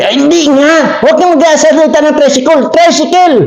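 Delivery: high-pitched exasperated correction; scolding uncle. Acted. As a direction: snap at the boy about "tricycle".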